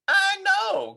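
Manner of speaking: put-on high loud kid's cry; child crying out. A narrator's reading. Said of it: "cried Froggy".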